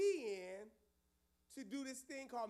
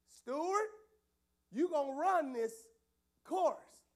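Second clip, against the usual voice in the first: loud demand, much louder; challenging Stewart; 2 semitones higher, 7 dB louder, slower than usual. Retold or imitated. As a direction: call him out in front of everyone. Pastor confronting Stewart.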